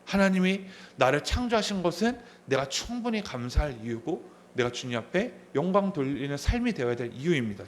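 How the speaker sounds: ordinary talk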